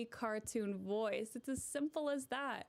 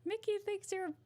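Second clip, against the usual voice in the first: very high squeaky cartoon mouse voice; Mickey Mouse impression; 9 semitones higher than usual. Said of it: "Yeah, Mickey Mouse. He's like".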